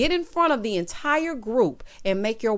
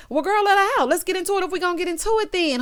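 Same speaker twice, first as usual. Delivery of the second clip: higher, excited cheerleading; hyped-up egging on; 9 semitones higher, faster than usual. Pretend. Viewer cheering on the wife to let her real self out, hyped up. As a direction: urge her on, pitched up and quickening.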